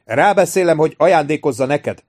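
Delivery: calling out. A narrator's reading. Shouting across to the ship.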